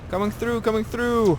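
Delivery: high, loud battle call; coming through shout. Real, not acted. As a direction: bellow it while charging in. Shouting while driving through.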